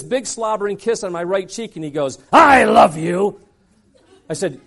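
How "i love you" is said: drawn-out I love you. Retold or imitated. Speaker after kiss.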